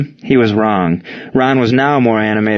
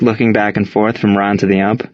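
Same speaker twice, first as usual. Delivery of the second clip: plain reading voice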